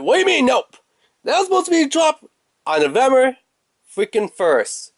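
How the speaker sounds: high-pitched retold disbelief; indignant protest. Customer retelling an argument with store staff, indignant. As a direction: protest in a rising, exasperated voice.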